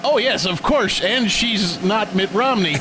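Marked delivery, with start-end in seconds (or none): none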